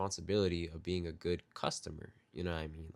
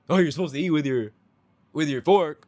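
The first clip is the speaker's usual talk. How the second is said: mock snooty, higher and louder; condescending server; 6 semitones higher, 7 dB louder than usual. Server acting out how she would never speak, snooty. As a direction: talk down to the diner. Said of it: "I would not speak to you".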